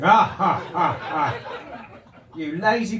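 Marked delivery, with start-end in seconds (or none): 0.0-1.3 mock upward laugh, ha ha ha; imitated laugh. Comic imitating a punter laughing at the ceiling, mock laugh. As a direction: ape the friend's laugh aimed at the ceiling. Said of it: "it laughs into the ceiling".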